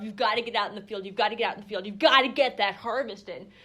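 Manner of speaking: pushy, loud and fast, like a hard-driving boss; mock urgent push. Farm boss urging workers out to the harvest, loud and fast. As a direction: hurry them out to the field, loud and brisk. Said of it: "in contrast with the grind of".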